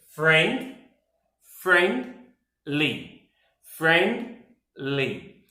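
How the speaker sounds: "friendly" broken slowly into friend - ly; two-syllable demo. Shown as a demonstration. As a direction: pause between "friend" and "ly" each time. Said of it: "it only has two syllables".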